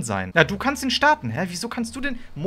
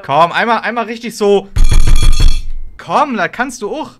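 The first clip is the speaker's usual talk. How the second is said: loud urging; 4 semitones higher, 14 dB louder than usual. Real, not acted. Urging friend to scream for content.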